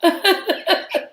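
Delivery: shrieking laugh, high up; a sudden cackle at the clip; real helpless laugh; high burst of laughter. Real, not acted. Reacting to clip.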